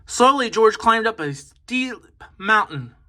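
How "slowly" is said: ordinary delivery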